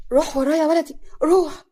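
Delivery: high, loud, urgent command; alarmed urging. Acted. Mother sending her son after his brother, alarmed and loud. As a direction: send him off in alarm. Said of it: "صفية بخطة".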